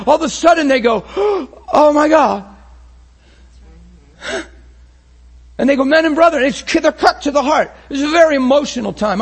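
loud, high, impassioned preaching; full-force preaching. Acted. Preacher to his congregation, impassioned full-force preaching. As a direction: preach it out to the whole room with fire.

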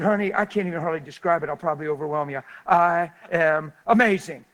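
loud, gushing retold boast; over-the-top answer. Preacher retelling his reply to cashier, over-the-top.

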